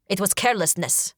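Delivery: plain reading voice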